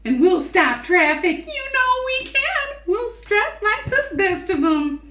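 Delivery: very high, fast, loud gushing hens; excited giggling hens. Acted. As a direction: squeal with delight like vain hens. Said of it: "the hens began clucking to each other".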